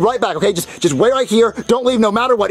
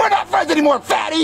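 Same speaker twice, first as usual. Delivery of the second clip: angry; 9 semitones higher than usual. Acted. Junior furious at Cody.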